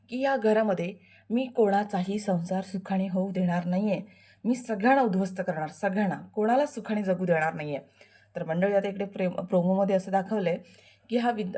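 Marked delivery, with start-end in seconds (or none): 0.2-7.8 menacing villain vow. Acted. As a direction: vow to ruin everyone. Villain vows ruin. (सर्वांना उद्ध्वस्त करण्याची शपथ घेणारा खलनायक, हळू धमकीच्या सुरात)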